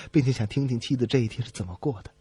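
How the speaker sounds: ordinary talk, nothing marked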